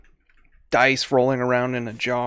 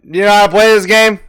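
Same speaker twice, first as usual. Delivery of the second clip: loud, raised-pitch challenge; gambling leader; 4 semitones higher, 7 dB louder than usual. Acted. Dice leader to new recruit, loud challenge. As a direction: call the rookie out briskly.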